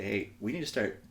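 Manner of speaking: ordinary delivery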